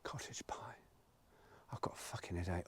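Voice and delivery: faint whisper of the words. Real, not acted. Vlogger muttering to himself while cooking, faint whisper. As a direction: barely breathe it.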